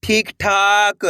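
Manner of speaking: ठीक ठाक slow, long vowels stretched; blended syllable reading. Shown as a demonstration. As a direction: draw out ठीक ठाक for learners. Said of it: "तो मिलके बन जाएगा". (ठीक ठाक को धीरे से खींचकर)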